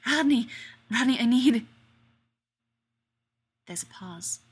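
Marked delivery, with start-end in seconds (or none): 0.1-1.7 loud desperate gasping plea. Acted. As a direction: beg through gasps. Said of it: "he gasps".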